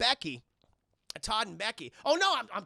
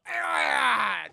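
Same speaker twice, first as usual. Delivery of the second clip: high, loud, long dying wail; hammed-up movie death scream; milk the death cry for laughs; drawn-out death cry; 5 semitones higher, 9 dB louder than usual. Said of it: "gets the longest death scene".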